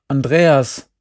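plain reading voice

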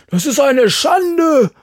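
slow, high bellowing outrage; a high, slow roar of indignation. A narrator's reading. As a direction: bellow it like a furious old pastor. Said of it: "brüllt er".

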